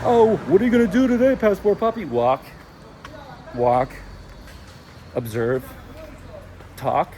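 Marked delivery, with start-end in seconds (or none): 0.0-2.0 high, loud, quick put-on voice; mock questioner. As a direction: imitate an eager questioner, pitched way up and rattled off. Voices someone asking about his day.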